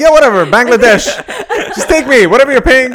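fast, loud, high and laughing; hammed-up desperate brush-off; eager dismissal. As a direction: blurt it out, too eager to care. Retold careless desperate reply on phone.

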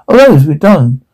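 usual speaking voice

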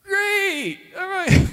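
a sheepish, cornered all right; reluctant agreement. Retold or imitated. Youth pastor cornered by his students, high nervous agreement. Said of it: "And I'm like".

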